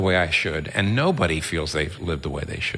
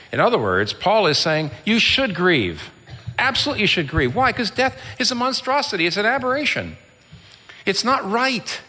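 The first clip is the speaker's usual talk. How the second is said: higher-pitched, emphatic preacher; impassioned preaching; 9 semitones higher than usual. Real, not acted. Preacher telling the congregation they should grieve, impassioned. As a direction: press each point home with rising urgency.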